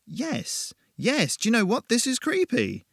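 high, jittery admission of fear; nervous high voice. A narrator's reading. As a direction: blurt it out, spooked. Admitting fear at haunted house.

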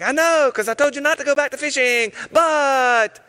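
playful I-told-you-so; knowing tease. Retold or imitated. Jesus teasing Simon Peter for going back to fishing, high knowing tease.

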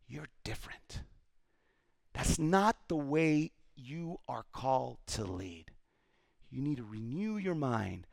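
Jesus gently telling his followers, hushed; tender hush. Acted. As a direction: speak it like a quiet promise.

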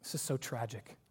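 hushed sorrow. Real, not acted. Preacher grieving the tragedy.

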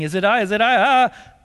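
hammed-up frantic disciples; mock panic. Preacher voicing the scrambling disciples in mock panic.

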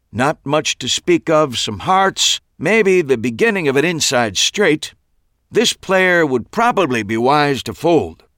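high, bright stage patter of a magician; showman patter. A narrator's reading. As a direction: play to the audience with a sly showman air. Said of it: "Max's stage patter".

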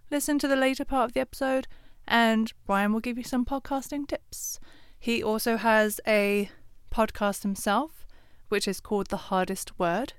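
normal talking voice

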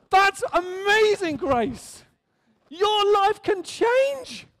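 high, drawn-out exultant cry; carried away, preaching with soaring fervor; real excitement. Real, not acted. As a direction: proclaim it slowly at a high pitch. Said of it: "I'm sorry I'm excited".

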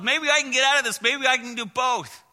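high, loud voice of the scheming monkey. Acted. Preacher voicing the monkey who won't let go, high and loud. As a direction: play the greedy monkey bargaining.

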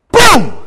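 sudden loud, high boom; crash imitation. Storyteller springs the crash on listeners, explosive boom. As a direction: burst out with a startling crash noise.

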